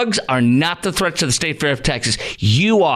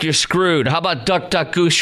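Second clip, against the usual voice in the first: usual speaking voice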